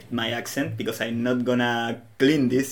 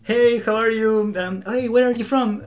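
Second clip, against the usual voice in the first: much higher, louder friendly questions; curious stranger; 9 semitones higher, 4 dB louder than usual. Retold or imitated. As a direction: imitate a stranger quizzing him. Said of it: "always like".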